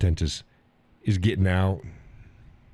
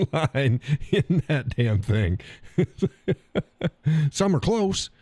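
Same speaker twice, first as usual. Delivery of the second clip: laughing; 7 semitones higher, slower than usual. Real, not acted. Laughing at his crooked build.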